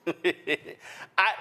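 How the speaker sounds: amused laugh running into speech; real laugh. Real, not acted. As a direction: start talking out of a laugh. Host amused by panelist's put-down.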